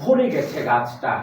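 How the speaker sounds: slow, low-pitched verse recital; drawn-out phrases in a deep, measured recital voice; poem recitation. Shown as a demonstration. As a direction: recite line by line for the class to echo. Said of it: "সবাই বলবে".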